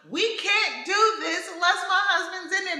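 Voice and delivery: retelling her frantic demand; mock panic. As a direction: plead like you're about to be cut open. Retold panic before surgery.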